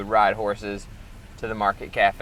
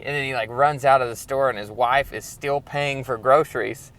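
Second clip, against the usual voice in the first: ordinary delivery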